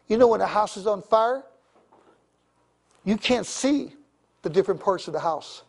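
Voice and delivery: ordinary talk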